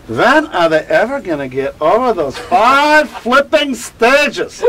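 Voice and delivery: put-on Swiss German accent, slow and high. Kubler-Ross to a friend, Swiss German accent. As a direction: drag on a cigarette, then Swiss German accent. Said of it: "in her Swiss German accent".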